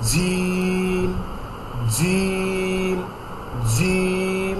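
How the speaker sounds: জিম said softly with its sound held long; soft drawn-out jim. Shown as a demonstration. Teacher showing students the letter jim, long and soft. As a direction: hold জিম long and soft, tongue tip on the front teeth. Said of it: "stress দিয়ে".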